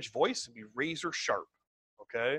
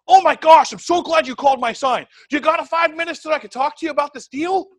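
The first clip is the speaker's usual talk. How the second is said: gushing, much higher and louder over-excitement; way too much; 10 semitones higher, 11 dB louder than usual. Shown as a demonstration. Caller overselling to Steven, way too excited. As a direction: oversell it like a frantic eager caller. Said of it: "But that's way too much".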